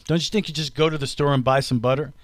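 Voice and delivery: usual speaking voice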